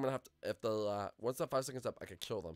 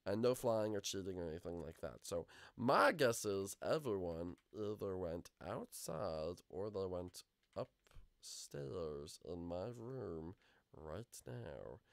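ordinary delivery

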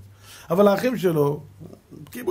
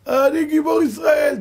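much higher, louder shouted bragging; mocking boast; 13 semitones higher, 7 dB louder than usual. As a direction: shout the boast to mock its pride. (לעג לגאווה, צעקה רמה וגבוהה)

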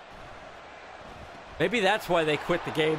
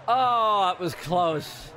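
normal talking voice

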